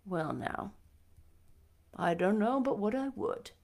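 put-on a shy elderly man, quietly agreeing; Matthew. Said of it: "said Matthew".

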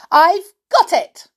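high, loud cry of triumph; triumphant cry. A narrator's reading. Amelia triumphant at grabbing the ink, crying out high. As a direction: crow it out in victory. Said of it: "she cried".

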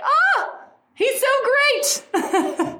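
high, slow, gushing sigh over him; dreamy swoon. Real, not acted. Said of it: "I'm just like".

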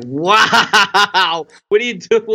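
mock awe through a laugh; retelling amazement while chuckling; laughing wonder. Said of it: "And I'm like".

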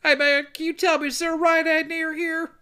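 silly high voice. Pretend. Man stuck inside the car asks the way, squeaky high. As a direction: play the trapped guy asking directions.

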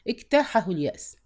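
usual speaking voice

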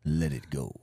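very low mock-calm voice; imitating calm Ali. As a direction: repeat it as the deep-voiced, unbothered friend. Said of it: "And he was like".

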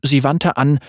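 ordinary delivery

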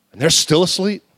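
ordinary talk

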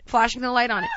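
normal talking voice

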